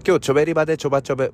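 rapid gyaru chatter, run together; fast gyaru slang. Shown as a demonstration. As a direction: rattle it off at full speed. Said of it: "repeat after me".

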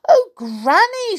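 put-on very high, loud child voice; startled storybook girl; little girl. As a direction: exclaim to granny in a high voice. Girl greets granny.

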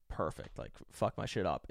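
low, soft, half-whispered deadpan; low quiet deadpan. Retold or imitated. Answering the doctor about the drugs, low and deadpan. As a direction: mutter it low and resigned. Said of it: "And I was like".

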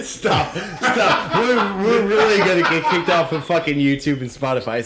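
pleading stop through laughter; laughing. Real, not acted. Host laughing and begging co-host to stop.

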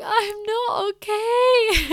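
high, loud, drawn-out pretend crying; mock tearful wail. Retelling her answer to the manager, mock bawling. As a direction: wail it slowly, hammed-up sobbing.

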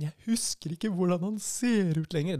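a hint of her tearful voice; mild mock of a sad woman; quoting the grieving woman. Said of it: "og så sier hun sånn".

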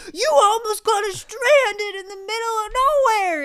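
high, loud crying rant; mock furious husband. Husband scolding her over the near-lost keys, high loud wail. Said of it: "He's like".

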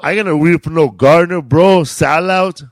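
loud, high, indignant heckle; Willie Barcena. Retold or imitated. Imitating a fellow performer scorning a role, loud high voice. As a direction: shout it as a scornful refusal. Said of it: "I remember Willie Barcena".

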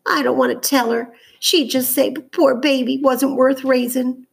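high, tearful girl's voice, lightly acted; starting to cry. A narrator's reading. Scylla dreads telling her mother about the sick child, mildly tearful. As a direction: voice a girl on the edge of tears. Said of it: "Scylla began to cry".

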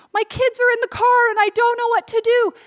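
high, loud frantic cry for help; mother shouting that her kids are in the car; panicked plea. Retold or imitated. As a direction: beg strangers for help in alarm. Panicked mother at own crash.

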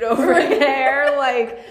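loud, drawn-out, laughing through it; amused, voice lifted into laughter. Real, not acted. Joking about gym dude, laughing.

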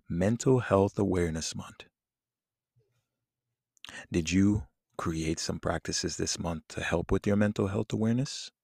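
ordinary talk, nothing marked

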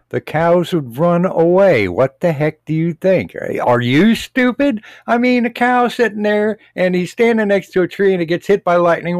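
high-pitched, incredulous rant; exasperated scorn. Acted. Radio host answering a silly farming letter, exasperated. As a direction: lecture them as if they had asked something stupid.

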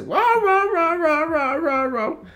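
high barks that sink as they go; dog barking. Retold or imitated. Telling his brother about the dog, high fading barks. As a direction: bark like the odd dog, fading down. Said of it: "le hace".

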